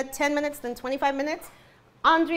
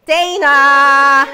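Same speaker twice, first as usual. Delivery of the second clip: loud call across the room; calling a friend over; 14 dB louder than usual. Real, not acted. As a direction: shout her name to get attention.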